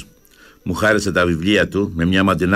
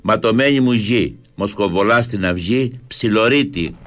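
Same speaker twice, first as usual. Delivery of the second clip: poem recitation; 3 semitones higher than usual. Acted. Host reading a poem about Crete on air, solemn recitation. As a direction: declaim it as a tribute to Crete.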